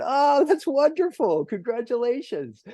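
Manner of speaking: put-on high-pitched gushing to a little kid; delighted praise. Retells gushing praise to small child.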